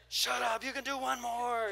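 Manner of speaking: playful imitation of a yelling trainer; mock trainer yell. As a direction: bark like a gym trainer. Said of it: "we pay them to yell at us too".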